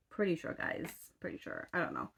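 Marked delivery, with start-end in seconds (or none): 1.2-1.7 whispered aside. Shown as a demonstration. Telling her viewers under her breath, in a whisper. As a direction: drop to a private whisper.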